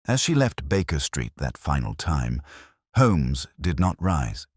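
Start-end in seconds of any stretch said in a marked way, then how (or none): none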